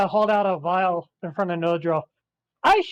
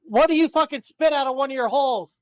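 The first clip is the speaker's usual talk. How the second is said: exasperated shout; 6 semitones higher than usual. Real, not acted. Shouted question at dragonborn.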